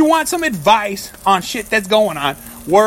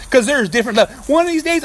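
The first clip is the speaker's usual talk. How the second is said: usual speaking voice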